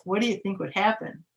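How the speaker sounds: usual speaking voice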